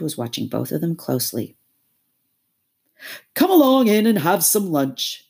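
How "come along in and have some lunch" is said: louder, higher smooth invitation; change the subject with charm; diplomatic. Acted. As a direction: Toad coaxing the others, a touch of diplomacy. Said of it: "he said diplomatically".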